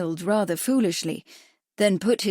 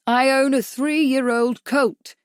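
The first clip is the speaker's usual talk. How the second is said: slow, loud, every word spelled out; said distinctly; 6 semitones higher, 5 dB louder, slower than usual. A narrator's reading. Bradford speaking slowly and loudly to a deaf doctor. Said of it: "he said distinctly".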